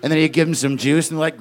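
ordinary delivery

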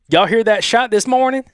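high-pitched Southern twang. Acted. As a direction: read it out with an Alabama twang. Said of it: "A little bit of twang".

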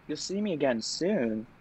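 quietly incredulous question; stunned disbelief. Retold or imitated. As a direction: repeat it back softly, barely believing it. Acts out her stunned reply.

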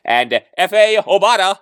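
usual speaking voice

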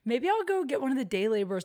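ordinary talk

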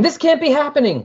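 high disbelieving cry; distraught. Retold or imitated. Kyle over the destroyed village, high and distraught. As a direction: refuse to believe what you see. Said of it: "And Kyle's saying".

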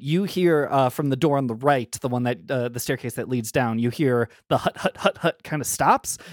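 normal talking voice

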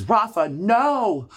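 high, slow pleading cry of no; alarmed cry. Retold or imitated. Wife begging her husband not to tell, high drawn-out cry. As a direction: stretch the no out high in horror. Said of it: "And, and Coco goes".

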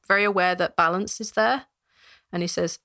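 ordinary talk

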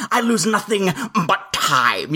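slow, drawn-out villain delivery of "I lose nothing but time"; exaggerated Krennic line. Shown as a demonstration. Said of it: "I have to exaggerate the line".

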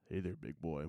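hushed, teasing come-on whisper; mock seductive whisper. Coworker teasing him like his wife would, mock seductive whisper. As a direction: coo it into his ear as a joke. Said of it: "whisper in your ear like your wife does".

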